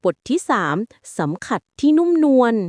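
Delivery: usual speaking voice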